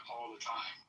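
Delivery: ordinary talk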